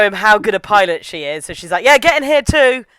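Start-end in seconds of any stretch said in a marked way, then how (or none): 1.8-2.9 high, loud, cocky command; bossy show-off. Retold or imitated. Boastful pilot hurrying Shinji into her robot, bossy. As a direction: order him in like a boastful pilot.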